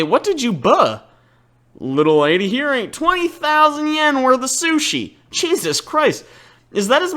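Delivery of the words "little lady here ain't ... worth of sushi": a Southern American sushi chef complaining, lightly drawled; Southern accent. Acted. Southern shopkeeper scolds the girl over the bill, mild Southern accent. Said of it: "I know I'm giving him, like, a Southern accent".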